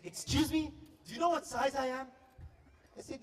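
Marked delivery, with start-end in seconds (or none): 0.0-2.1 offended. Retold or imitated. Insulted customer snaps back at the shopper, indignant. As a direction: bristle at the insult.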